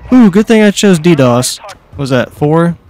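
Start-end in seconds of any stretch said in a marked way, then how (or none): 0.1-1.6 high, loud, gleeful ooh; delighted ooh. Real, not acted. Gamer thrilled by his kill reward, loud and high. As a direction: relish the payoff out loud.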